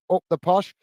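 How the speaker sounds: ordinary talk, nothing marked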